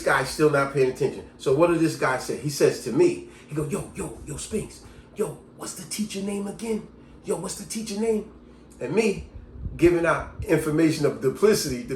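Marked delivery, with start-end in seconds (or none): 3.7-8.3 softer, slightly higher aside; hushed classmate. Acted. Late student asks a classmate the teacher's name, hushed. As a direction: sneak the question under the teacher's talk.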